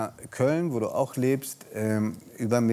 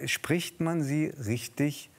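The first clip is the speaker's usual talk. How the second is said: usual speaking voice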